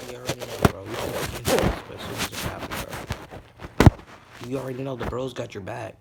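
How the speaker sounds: big brother energy, loud and emphatic; loud bro voice. Acted. Boys reassuring Izuku, loud bro talk. Bros reassuring him loudly.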